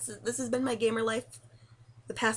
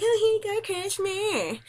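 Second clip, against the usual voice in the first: much higher, sugary gift voice; sweet gift-giving voice; 12 semitones higher, 5 dB louder, slower than usual. Retold or imitated. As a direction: hand over the present all sweetness. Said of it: "So I'm like".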